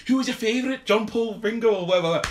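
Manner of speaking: high, loud imitation of a Scouse fan; Scouse accent. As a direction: ham up a Liverpool Scouse accent. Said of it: "you hear the Scouse accent".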